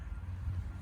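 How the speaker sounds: a quiet, voiceless gasp; a soft intake of breath in wonder. Acted. Birds follow her from church.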